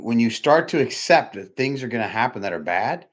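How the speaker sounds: ordinary delivery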